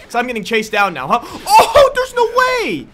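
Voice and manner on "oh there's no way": high, loud, drawn-out disbelief; shocked yell. Real, not acted. Streamer stunned by an enemy's long range, yelling.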